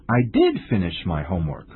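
emphatic "did", pitch falling after it; stress on did. Shown as a demonstration. Answering someone who says the homework wasn't finished, stress on "did". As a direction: hit "did" to contradict the accusation. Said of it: "Emphatic sentences are usually used after".

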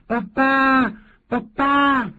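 put-on high little boy calling out; child calling dad. Little son calling after his father on the bike, high and childlike. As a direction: call for daddy like a small son. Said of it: "儿子就说".

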